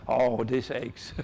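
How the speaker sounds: slow, drawn-out words between laughs; laughing. Real, not acted. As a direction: groan it out through real laughter. Laughing at audience's reply.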